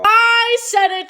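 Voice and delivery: high, slow, cheeky declaration; defiant little cheer; bold exclamation. Real, not acted. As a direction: own the hot take proudly. Owning a bold opinion.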